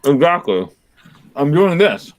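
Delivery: talking through a mouthful of pizza; words round a bite of food; mouth full. Real, not acted. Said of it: "I'm talking with my mouth full".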